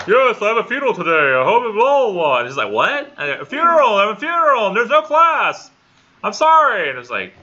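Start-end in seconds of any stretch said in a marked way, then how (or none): 0.1-2.4 loud, high-pitched rapid announcement; disembodied voice. Retold or imitated. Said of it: "here's a disembodied Nichols voice going". 2.7-3.1 high-pitched mock-confused "what?"; baffled reply. Retold baffled listener replying. 3.3-6.9 high, loud, hammed-up announcement; disembodied voice shouting. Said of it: "here's a disembodied Nichols voice going".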